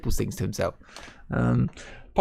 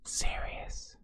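breathy dramatic whisper; 10 dB quieter than usual. Acted. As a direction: whisper it like a secret. Echoes the serious promo title.

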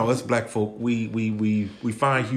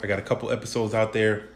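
usual speaking voice

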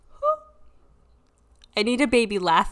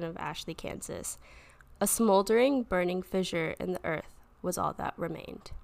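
story narration; 2 semitones lower, 3 dB quieter, faster than usual. A narrator's reading.

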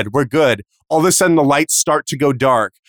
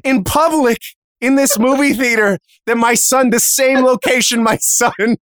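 appalled mom; 8 semitones higher than usual. Retold or imitated. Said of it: "I'm like".

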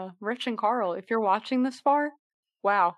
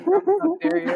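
mock outrage; 10 semitones higher, 9 dB louder than usual. Mock outrage at Game Grumps.

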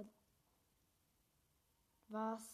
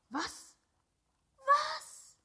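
high, loud, thrilled outbursts; real excited gamer yelling; excited shouting; 12 semitones higher, 12 dB louder, slower than usual. Real, not acted. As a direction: yell it like you just found treasure. Finding softpacks back in game.

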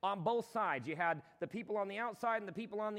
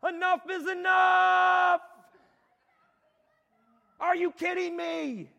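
huge high shout, slowed; a full-voiced cry of exasperation; yelling at God; 11 semitones higher, 13 dB louder, slower than usual. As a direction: bellow it out to God in frustration. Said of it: "and I'm like".

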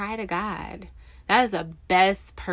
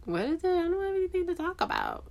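high, quick, puzzled brush-off; puzzled protest; 11 semitones higher, faster than usual. Retold or imitated. Telling her viewers how she answered God, high and baffled. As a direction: squeak it out fast, genuinely baffled. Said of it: "And I'm like".

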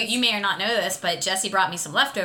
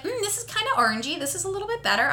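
guest tasting; 10 semitones higher, 3 dB quieter, faster than usual. Retold or imitated. Imitating guests tasting punch.

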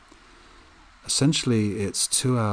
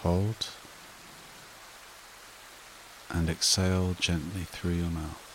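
soothing breathing-exercise delivery, spaced out and unrushed; guided meditation; 7 dB quieter than usual. Acted. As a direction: guide them gently into calm, phrase by unhurried phrase.

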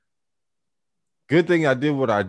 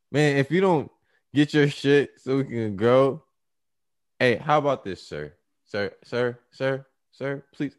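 mock polite pleading; 5 semitones higher, slower than usual. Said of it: "started talking to him like that".

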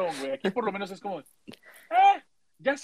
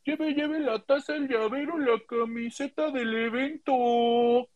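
high, slow sing-song hawker call; street vendor cry; 6 semitones higher, much slower than usual. Retold or imitated. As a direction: call out souvenirs like a market seller. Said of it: "o sea".

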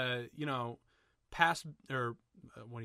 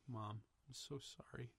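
very soft, quiet quote; hushed apology; 13 dB quieter than usual. Retold or imitated. Retelling Barry's apology to his mom, hushed. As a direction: imitate a hushed film apology. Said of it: "it went completely quiet".

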